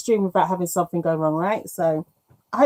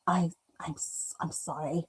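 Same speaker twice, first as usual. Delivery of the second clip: hushed unvoiced apology; sheepish whispered sorry; 2 semitones lower, 11 dB quieter than usual. Real, not acted. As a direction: breathe the apology with no voice. Apologising while muted, whispering.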